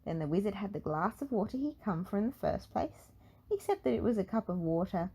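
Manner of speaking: ordinary delivery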